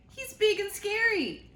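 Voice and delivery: high loud squeaky fright; scared squirrel. Acted. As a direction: squeal it out terrified. Squirrel fears the dog.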